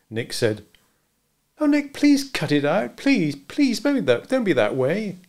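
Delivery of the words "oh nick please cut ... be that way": put-on much higher, pleading young woman. A narrator's reading. Marjorie pleading with Nick to stop being mean. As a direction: beg him to stop, pitch lifted.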